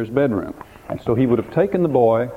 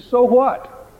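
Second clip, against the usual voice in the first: high, louder sneering so what; mocking Satan; 12 semitones higher, 5 dB louder than usual. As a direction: toss it off like a scornful devil. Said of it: "Satan's question is".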